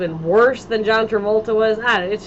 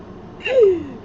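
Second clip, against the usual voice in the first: excited gasp; 11 semitones higher than usual. Real, not acted. Excited about [name] concert news.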